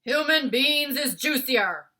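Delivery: loud, slow, booming giant voice; a giant bellowing at his dinner guest; Bloodbottler giant. A narrator's reading. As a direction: boom it out like a greedy brute. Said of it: "the blood bottler said".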